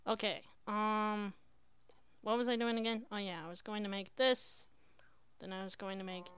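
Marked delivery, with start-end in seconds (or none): none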